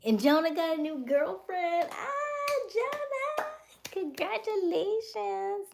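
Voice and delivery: high-pitched, loud gushing; drawn-out, sing-out cheer in a very high voice; excited congratulations. Real, not acted. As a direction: squeal it out in delight for a friend. Congratulates viewer on new girlfriend.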